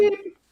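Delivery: high beep like a scanner; scanner beep imitation. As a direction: mimic the checkout beep.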